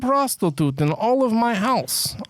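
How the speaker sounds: high, proud mock-Kazakh voice; exaggerated Borat boast. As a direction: pitch it way up in a comic foreign accent. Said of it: "What's another Borat one?".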